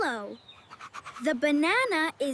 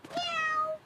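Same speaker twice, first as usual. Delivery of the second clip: cat meow; 9 semitones higher, 4 dB quieter than usual. Shown as a demonstration. A kitten answers the teacher's question, high cat meow. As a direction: Meow squeaked much higher like a kitten. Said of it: "The cat says meow".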